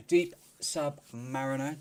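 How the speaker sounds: slow, careful reading of a tricky name; really slow. Shown as a demonstration. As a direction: spell out the name slowly so as not to fumble it. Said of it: "I have to say that name really, really slow".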